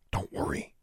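voiceless whisper; mouthed silently. A narrator's reading. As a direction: barely breathe the words, no voice. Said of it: "she mouthed silently".

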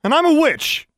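very high, loud yell; furious shout. A narrator's reading. Daughter revealing her secret to mother, furious shout. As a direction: hurl it out at full voice. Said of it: "Alexandra shouted".

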